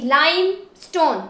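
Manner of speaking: Limestone as lime, then stone; syllable by syllable. Shown as a demonstration. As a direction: separate the two parts of limestone. Teacher splits word into syllables.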